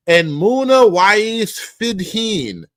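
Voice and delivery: slow, strained throaty say of Fidheen; throaty name reading. Shown as a demonstration. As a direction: force the names from the throat, slowly. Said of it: "causes you pain to talk like this".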